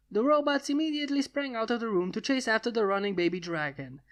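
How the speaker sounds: usual speaking voice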